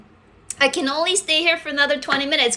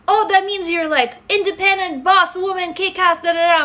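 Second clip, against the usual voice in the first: higher, lightly voiced excited friend explaining; imitating a friend; 6 semitones higher than usual. Someone explains the queen nickname, lightly voiced upbeat friend. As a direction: chirp it like an eager friend. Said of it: "they're like".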